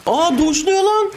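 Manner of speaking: high startled cry of alarm; panicked high-pitched yelling at the monster; shocked high yell. Real, not acted. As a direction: yelp it out as the monster lunges.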